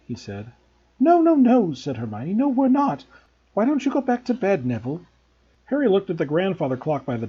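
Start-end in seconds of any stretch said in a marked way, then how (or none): 1.0-1.7 put-on high, quick girlish denial; Hermione's voice. A narrator's reading. 2.3-5.0 high, light nervous brush-off; Hermione's voice. A narrator's reading.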